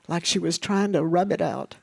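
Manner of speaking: plain reading voice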